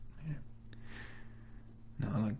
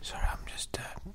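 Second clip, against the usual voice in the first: whispering; 8 semitones higher than usual. Acted. Woken, whispered apology.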